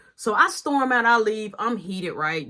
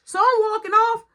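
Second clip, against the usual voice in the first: ordinary talk, nothing marked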